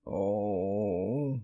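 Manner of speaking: long, hollow "oh" moan; drawn-out hollow groan. A narrator's reading. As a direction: moan it out on one held breath. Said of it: "answered with a hollow moan".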